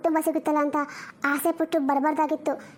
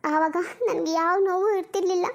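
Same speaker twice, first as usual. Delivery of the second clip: ordinary talk, nothing marked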